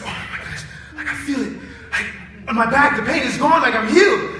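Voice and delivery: breathless hush rising into a loud, high, laughing cry; a student realising her back pain is gone, building from hushed to loud; excited disbelief. Retold or imitated. Starts unvoiced and hushed, ends loud, high and laughing. Student discovers she is healed.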